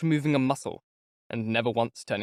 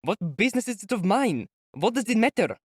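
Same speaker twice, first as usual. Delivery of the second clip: impatient shout; 8 semitones higher than usual. A narrator's reading. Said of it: "he shouted suddenly in bewilderment and impatience".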